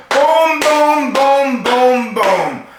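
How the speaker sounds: high, loud crash booms that fade down; mock pileup booms. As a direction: pound out each crash, dying away. Four-car pileup sound retold.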